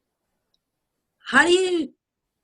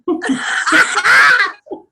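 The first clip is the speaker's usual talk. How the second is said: loud, high cackling laugh; burst of laughter; 11 semitones higher, 9 dB louder than usual. Real, not acted. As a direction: burst out laughing at your own joke. Laughing at own cockiness joke.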